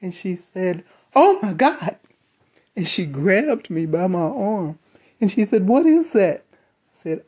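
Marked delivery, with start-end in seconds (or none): 1.1-2.0 high, loud frightened exclamation; alarmed coworker. Retold or imitated. Coworker hears the eerie voice. 5.7-6.4 high, loud frightened exclamation; alarmed coworker. Retold or imitated. Coworker reacts to the eerie voice.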